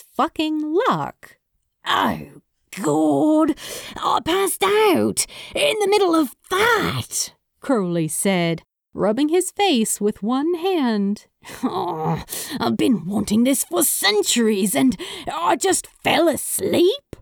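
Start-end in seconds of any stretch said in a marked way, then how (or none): 1.9-7.3 high-pitched, exasperated wail of disbelief; mortified outburst. A narrator's reading. Crowley to his lover after passing out mid-moment, mortified. 11.6-17.2 quick lament climbing into high disbelief; exasperated lament. A narrator's reading. Crowley bemoans falling asleep after centuries of wanting, exasperated.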